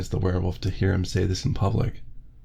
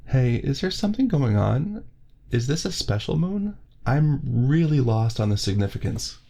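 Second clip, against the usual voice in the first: a higher, puzzled heavyset guy; lightly voiced Dominic; 6 semitones higher than usual. Dominic asking the group about the moon, puzzled and higher. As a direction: ask like you're lost, pitched up.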